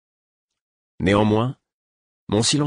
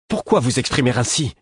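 angry exclamation; 5 semitones higher than usual. A narrator's reading. Hurt prince snapping at his mentor, angry. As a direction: throw the question back in offended anger. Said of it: "s'exclama-t-il avec colère".

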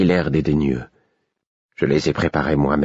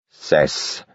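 without raising voice. A narrator's reading. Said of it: "sans élever le ton".

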